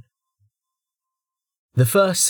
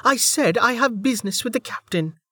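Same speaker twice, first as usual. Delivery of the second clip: higher, curt voice; irritated woman; 12 semitones higher than usual. Acted. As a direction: repeat it with annoyance. Velika retorts to soldier.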